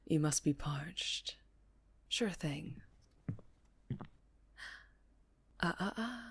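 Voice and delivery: a crime boss toying with his prisoner, calm and taunting; menacing mob boss. Acted.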